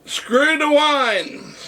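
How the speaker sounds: high, stretched-out rallying cry; retold call to the room. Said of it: "I turned to the people in the room and I said".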